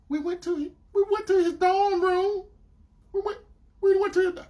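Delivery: high-pitched, flustered imitation; stammering girlfriend. Girlfriend confronted about the dorm room, imitated flustered. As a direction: stumble over it in a high mimicking voice.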